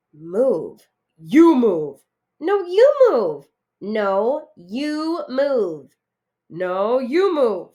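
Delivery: loud bickering, each 'you move' pushier; cookie roommates squabbling. Acted.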